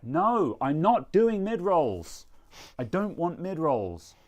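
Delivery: high, loud, drawn-out refusal; mock protest. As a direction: refuse with big, stretched-out indignation. Mock refusal of mid rolls.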